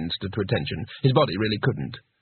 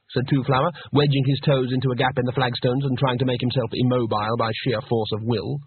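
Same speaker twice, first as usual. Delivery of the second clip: usual speaking voice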